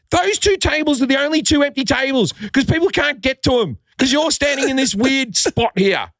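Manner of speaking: high-pitched exasperated complaint; losing his cool. Retold or imitated. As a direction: rant shrilly about the blocked tables. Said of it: "I've lost my, my, my cool".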